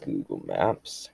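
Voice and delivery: breathy, slow whispered command. Real, not acted. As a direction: whisper it slowly to the phone.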